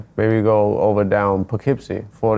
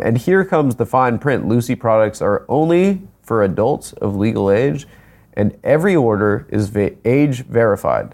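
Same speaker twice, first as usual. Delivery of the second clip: ad read. Shown as a demonstration. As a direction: lightly read sponsor fine print. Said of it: "I have to read verbatim".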